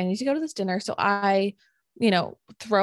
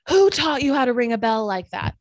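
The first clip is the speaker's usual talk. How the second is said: loud, higher angry yell; retold yell; 4 semitones higher, 6 dB louder than usual. Old man yelling at her over the buzzer, loud and angry. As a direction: bark it like an outraged old neighbour. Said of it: "he yells".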